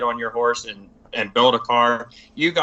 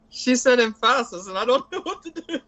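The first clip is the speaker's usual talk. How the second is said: laughing; 11 semitones higher than usual. Real, not acted. Cracking up at the emphasis joke.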